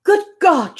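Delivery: high startled exclamation; a shocked gasp of words; startled cry. A narrator's reading. Reader voicing Emma's shocked cry at the news. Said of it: "she cried".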